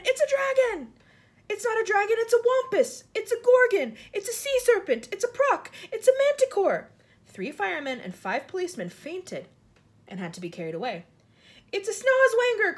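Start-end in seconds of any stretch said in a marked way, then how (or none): 0.0-6.9 very high, loud cries of alarm; panicked shouting. A narrator's reading. Frightened crowd naming the monster above them, shouting. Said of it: "all started shouting at once". 11.7-12.7 very high, loud yell; alarmed cry. A narrator's reading. Police chief identifying a monster, crying out. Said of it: "cried the poli-, chief of police".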